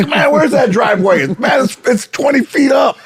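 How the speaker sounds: lightly voiced friend hunting for the driveway; panicked driver. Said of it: "He's driving like it's a golf cart".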